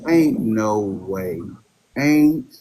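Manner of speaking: ordinary talk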